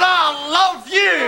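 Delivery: slow, drawn-out cry of love; emotional outburst. Acted. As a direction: stretch it out, overcome with love. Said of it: "I get so emotional".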